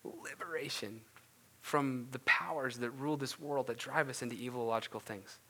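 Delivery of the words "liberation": whispered, weighty repeat; hushed emphasis. Real, not acted. Preacher stressing what obedience gives, hushed whisper. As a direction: drop to a whisper to let it land.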